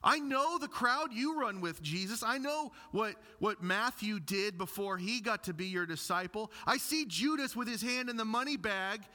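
high, cocky boast; smug young man. Retold or imitated. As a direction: brag to Jesus like a spoiled kid. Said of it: "like he's saying to Jesus".

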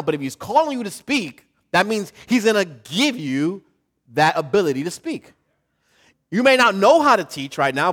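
rising sermon build; full-force preaching. Acted. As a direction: preach it to the whole church.

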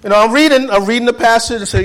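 high, loud, fast telling; excited preaching. Real, not acted. Preacher describing his Bible reading, excited and high. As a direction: rush it out high and keen.

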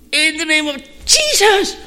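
high, loud shout of command; commanding rebuke. Retold or imitated. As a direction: cast the demon out with full force. Friend finally rebukes the demon.

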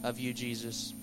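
soft, low, even praying voice; closing prayer. Real, not acted. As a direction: pray quietly and earnestly over the congregation.

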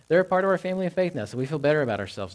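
high, quick mocking imitation; mock self-satisfaction. Preacher parodying why people welcome church members, mock chirpy delivery. Voicing the attitude he rejects.

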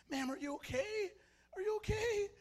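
high-pitched, anxious checking on her; concerned. Acted. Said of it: "the old man is now concerned about her".

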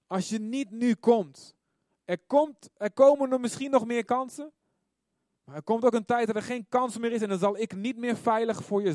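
high, loud preaching at full force; urgent warning. Acted. Preacher voicing God warning of last chances, loud urgent warning.

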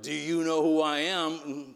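slow, pompous mock challenge; cartoonish rank-puller's voice; bullying intimidation. Preacher voices the devil trying to intimidate believers, pompous bullying voice. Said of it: "the devil comes and tries to bully us".

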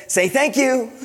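put-on high, loud, laughing child parroting "Say thank you"; laughing son imitation. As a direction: mimic the kid reciting the whole note, laughing. Mimicking literal son, laughing.

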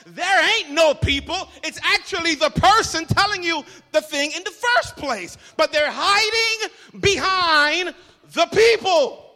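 full-force pulpit emphasis; preaching loud. Real, not acted. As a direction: build to a loud preaching punch. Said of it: "preacher rising to full force".

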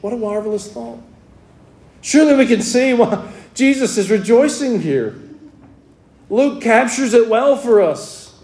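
fervent preaching. Acted. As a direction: preach it out with full conviction to the congregation.

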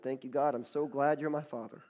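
rising, declamatory praise to God; fervent prayer. Retold or imitated. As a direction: preach it out as heartfelt thanks. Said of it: "He began just talking to God".

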